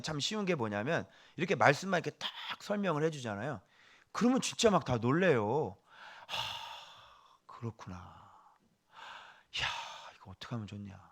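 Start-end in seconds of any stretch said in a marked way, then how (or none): 6.3-11.0 hushed, amazed murmur of a listener; awed murmur. Retold or imitated. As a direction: breathe it out in quiet wonder. Awed listener voiced in sermon. (놀라서 조용히 중얼거리는 목소리)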